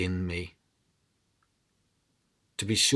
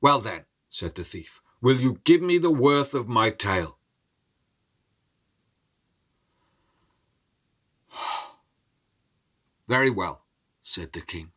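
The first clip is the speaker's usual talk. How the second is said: measured storyteller voice, dramatic rises and hushes; story narration. A narrator's reading. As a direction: tell the tale to a listening crowd. Storyteller narrating a folk tale.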